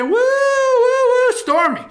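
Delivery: high, drawn-out hooting cheer; whooping at the stage. Acted. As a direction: whoop like a rowdy fan at a show. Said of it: "I was at the stage and I said".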